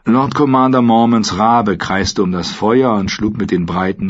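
ordinary delivery

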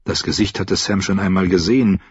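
usual speaking voice

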